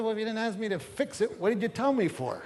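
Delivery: hammed-up exasperated husband; mock exasperation. Acts out his exasperated reply.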